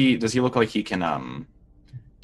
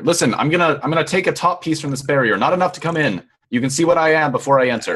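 raised, higher voice through a barrier; calling out; 9 semitones higher, 6 dB louder than usual. Acted. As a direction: announce your moves loudly to someone inside. Character calls through barrier to stranger.

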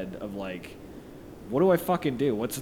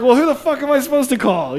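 exasperated outburst; 13 semitones higher, 10 dB louder, faster than usual. Retold or imitated. Exasperated retort to police.

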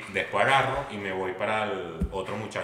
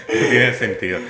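laughing; 4 semitones higher, 9 dB louder than usual. Real, not acted. Friend's story gets a laugh.

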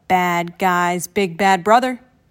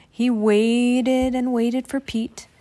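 usual speaking voice

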